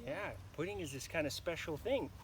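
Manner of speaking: ordinary delivery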